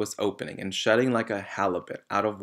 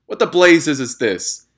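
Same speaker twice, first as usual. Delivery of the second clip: loud, higher bellow of rage; furious yell; 7 semitones higher, 12 dB louder than usual. A narrator's reading. Trunchbull yelling at the writing chalk. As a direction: roar it at the class. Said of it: "yelled the Trunchbull".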